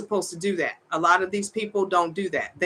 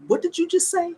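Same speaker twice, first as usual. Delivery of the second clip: much higher, incredulous demand; stunned disbelief; 11 semitones higher than usual. Retold or imitated. Retelling her confrontation with the woman, incredulous. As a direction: replay the outraged question, pitch jumping up. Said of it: "I said".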